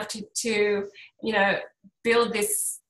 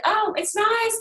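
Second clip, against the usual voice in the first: mildly pleased; 11 semitones higher, slower than usual. Retold or imitated. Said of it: "you think".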